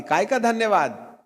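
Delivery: high scoffing question; mocking inner voice. Mind mocking his prayer, high scoffing question. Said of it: "अवचेतना से चेतना में बात आती".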